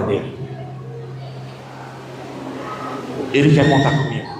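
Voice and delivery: humble heartfelt confession; solemn testimony. Real, not acted.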